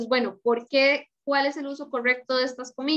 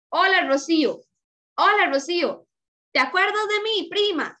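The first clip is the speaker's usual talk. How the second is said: lightly exclaimed greeting, pitch higher; 6 semitones higher, 3 dB louder than usual. Shown as a demonstration. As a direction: read it as a cheerful exclamation to a cousin. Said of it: "No le está exclamando".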